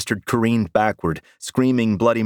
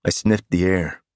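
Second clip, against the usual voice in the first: ordinary delivery, nothing marked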